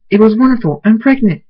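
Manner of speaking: high, loud happy announcement; overjoyed. A narrator's reading. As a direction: gush the good news.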